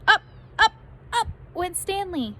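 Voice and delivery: excited narration climbing up; soaring excitement. A narrator's reading. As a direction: let the voice fly up with him. Kite soaring, voice lifts.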